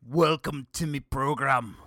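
gruffly hammed-up Scottish accent, loud and slow; exaggerated cranky Scottish brogue; mock Scottish accent. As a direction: put on an angry Scot, loud and drawn out. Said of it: "The Scottish voice would definitely be".

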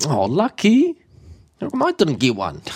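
high, far-off jealous lucky?; a playful pout of envy; envious. Retold or imitated. Someone envying a friend's luck, mock jealous. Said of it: "We're like".

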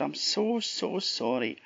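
acted apology. Chuck apologises for nearly harming the lassie, contrite.

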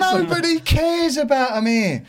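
high-pitched exasperated retort; worked-up, high protest; retold heated row. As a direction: argue it right back at the boss.

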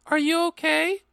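very high acted girl voice; high-pitched concerned character question; girl's part. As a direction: ask in a high, girlish character voice. Girl's part in fan fiction.

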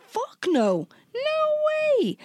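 retold refusal leaping into a very high, drawn-out squeal; emphatic refusal. Recounting how she refused his lift, squealed refusal. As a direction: refuse outright, voice shooting up high.

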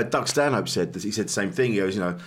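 ordinary talk, nothing marked

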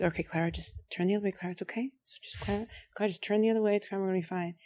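hushed urging. Retold or imitated. Soothing her friend on the train, hushed and hurried. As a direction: rush it under your breath to calm her. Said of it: "And I was like".

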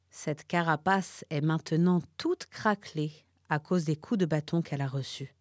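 ordinary delivery

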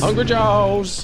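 high, loud, drawn-out echo; mock battle roar. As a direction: call out the attack name like a war cry. Echoes the player's roared attack name.

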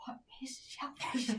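slow, hushed whisper of alarm; panicked whisper. Retold or imitated. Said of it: "he's, like, panicking".

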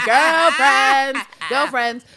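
high, loud mock squeal; hammed-up excited shout; gushing girlfriends cry. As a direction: whoop it like besties. Joke expected squeal of girlfriends.

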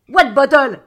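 loud captain's puzzled question; shouted retort. Acted. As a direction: fire the question back across the deck.

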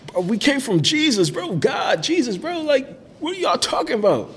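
high, loud mock outrage; incredulous objection. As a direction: imitate friends protesting, pitch shooting way up. Roommates object to evolution idea.

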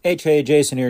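normal talking voice

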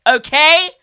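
firm and loud, not backing down; vexed demand. Retold or imitated. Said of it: "I was like".

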